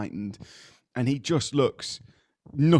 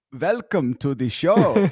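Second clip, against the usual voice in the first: slow, louder hammed-up German accent; caricature German accent; 4 semitones higher, 5 dB louder, slower than usual. Replaying his audition tape to co-host, cartoonish German accent. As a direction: draw it out as an over-the-top German show host. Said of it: "caricature of a German accent".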